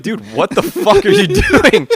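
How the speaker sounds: high, slow put-on outrage; mock yell at a roommate stomping upstairs; mock exasperated yell. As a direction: call out in mock disbelief at the noise.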